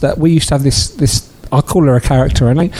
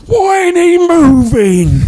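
angry shout; 12 semitones higher, much slower than usual. Retold or imitated. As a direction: bellow it like a furious old passenger. Angry passenger shouting on bus.

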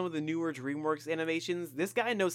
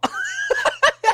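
high giggle; 11 dB louder than usual. Real, not acted. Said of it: "sounds like he just showed up to A A".

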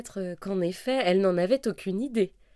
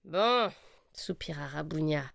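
plain reading voice

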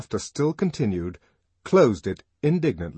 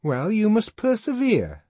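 high, loud moralising; sententious; 13 semitones higher, 5 dB louder than usual. A narrator's reading. Fanny advising Lenina, sententiously. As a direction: lay it down like a maxim. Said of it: "said Fanny sententiously".

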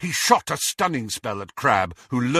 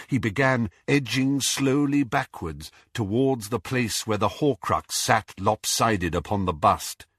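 plain reading voice

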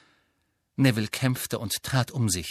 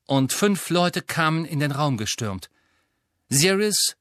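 plain reading voice

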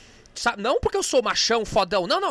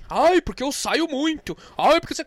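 mocking whiny quote; 6 dB louder than usual. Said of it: "tu tá reclamando do quê".

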